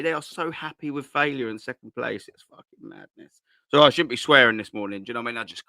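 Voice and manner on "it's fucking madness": breathless whisper, no voice at all; whispered swear. Real, not acted. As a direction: whisper it like a guilty aside. Muttered swear aside.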